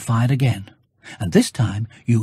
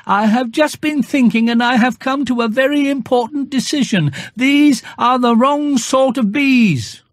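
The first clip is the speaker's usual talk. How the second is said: higher, earnest little bear voice; a small bear making a serious plan, voiced higher; Pooh's voice; 11 semitones higher, 3 dB louder than usual. Pooh speaks from the cloud.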